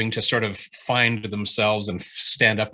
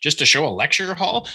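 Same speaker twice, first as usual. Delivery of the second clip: mock angry reader; 9 semitones higher than usual. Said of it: "He says".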